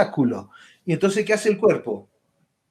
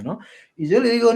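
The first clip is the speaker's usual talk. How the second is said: ordinary delivery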